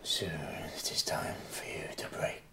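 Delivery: fast breathy whisper; ghostly whisper. Acted. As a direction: hiss it like a spirit. Spirit voice on the wind.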